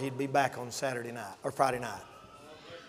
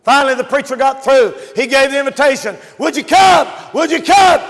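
high, raised altar-call voice; forceful preaching; 8 semitones higher, 11 dB louder than usual. Real, not acted. Preacher retelling the invitation to come, calling out forcefully.

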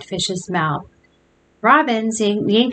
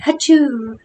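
higher, hammed-up fake achoo; mock sneeze; 5 semitones higher, 3 dB louder than usual. A narrator's reading. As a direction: break in with a pretend sneeze. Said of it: "cried Joe".

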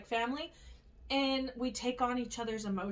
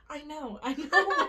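slow, half-laughing concession; sheepish admission; 3 semitones higher, 4 dB louder, slower than usual. Retold or imitated. Admitting to Wilbur it was unnecessary, sheepish.

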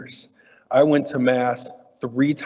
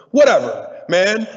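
mock-dismissive, louder and higher; shrugging it off; 6 semitones higher, 6 dB louder, slower than usual. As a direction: brush it off with a loud careless shrug. Shrugging off failing first grade.

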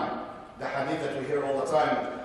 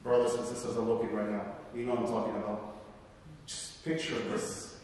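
hushed; 6 semitones lower, 8 dB quieter than usual. Acted. Sermon on Gaza, voice drops.

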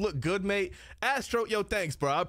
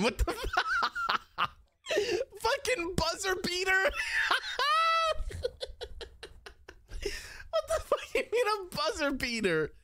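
high squealing laughter through the words; cracking up, can barely talk; laughing hysterically; 12 semitones higher than usual. Real, not acted. Streamer reading a donation, laughing hard.

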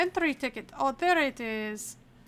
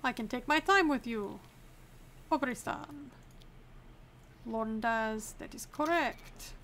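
hammed-up mock Arstotzkan accent; deliberately bad Arstotzkan accent; awful Arstotzkan accent. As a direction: play a border inspector with a put-on Arstotzkan accent. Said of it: "I cannot stop doing this awful Arstotzkan accent".

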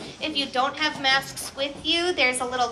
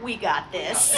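mock macho "We got this" through laughter; playful brave boast, laughing; imitating Kate; 4 dB louder than usual. Pastor teasing Kate in the back, mock tough boast. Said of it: "Kate's back there going".

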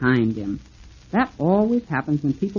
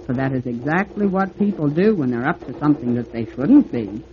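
ordinary delivery

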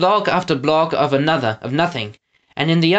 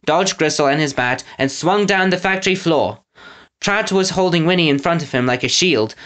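ordinary delivery, nothing marked

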